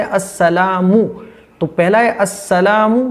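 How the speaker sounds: "अस्सलामु" with long "ला" and full "मु"; correct first word. Shown as a demonstration. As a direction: set "अस्सलामु" apart as its own word. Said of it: "पहला word जो है". ("अस्सलामु" अलग, साफ़)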